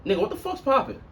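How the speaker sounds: high, incredulous challenge; a playful street challenge; mock confrontation. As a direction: call him out, half-joking.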